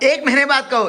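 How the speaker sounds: teasing. Real, not acted. Family teasing about return date.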